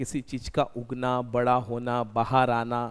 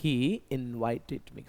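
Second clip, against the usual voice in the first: very slow, spaced model sentence, said twice; slow dictation; 3 semitones lower, 4 dB quieter, much slower than usual. Shown as a demonstration. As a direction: spell it out slowly for the class. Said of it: "कैसे बनेगा?".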